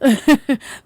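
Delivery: loud, quick laugh; burst of laughter. Real, not acted. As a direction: let a real laugh burst out. Laughs while recalling the kind agent.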